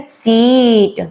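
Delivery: सीट with the ee held long; slow, stretched-out सीट; long vowel demo. Shown as a demonstration. Teacher stretching the long vowel in सीट. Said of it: "stress पड़ रहा है letter पे".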